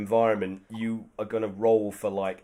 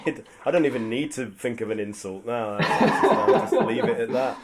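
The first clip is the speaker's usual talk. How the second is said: laughing through the words; 4 dB louder than usual. Real, not acted. DM laughing at the player's self-burn joke. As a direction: chuckle the line out. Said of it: "laughing while talking".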